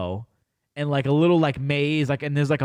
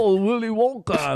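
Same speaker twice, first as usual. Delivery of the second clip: higher-pitched hammed-up British accent; mock British accent; 6 semitones higher than usual. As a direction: put on a silly British exclamation. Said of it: "they have accents like that".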